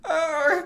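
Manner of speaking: high-pitched imitated meow; squeaky put-on cat noise; cat impersonation. Shown as a demonstration. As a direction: mimic a cat's cry. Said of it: "I can do an impersonation of my cat".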